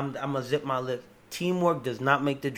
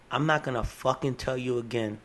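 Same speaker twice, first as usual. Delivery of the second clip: ordinary talk, nothing marked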